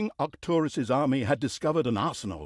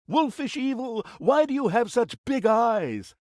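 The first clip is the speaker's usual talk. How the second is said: put-on higher, wide-eyed storybook girl; 7 semitones higher than usual. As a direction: ask like the girl in the fairy tale. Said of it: "fairy-tale question to the wolf".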